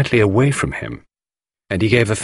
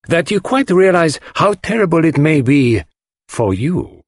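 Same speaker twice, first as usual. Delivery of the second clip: higher-pitched, weighted warning; pointed; 9 semitones higher, 3 dB louder than usual. A narrator's reading. Poirot warns the widower of his position, pointedly. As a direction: press the point home meaningfully. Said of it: "said Poirot pointedly".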